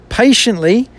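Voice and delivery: "patiently" pitched high as a question. Shown as a demonstration. Reader doubting the psalm's word, questioning "patiently" in a high voice. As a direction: lift "patiently" into a doubtful query. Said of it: "question mark".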